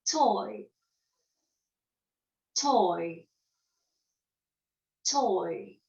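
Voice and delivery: careful "Toy" for the class; teacher modelling "Toy" for repetition; model word. Shown as a demonstration. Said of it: "Répétez".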